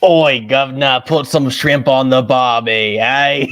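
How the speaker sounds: loud, drawn-out mock Cockney-Australian accent; hammed-up accent. Guest introduced after the British accent bit, hammed-up mock accent. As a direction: belt out a terrible Cockney-Aussie accent, slowly.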